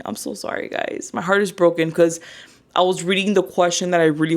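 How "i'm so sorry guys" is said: unvoiced sorry; whispered apology. Real, not acted. Apologizing after failed live.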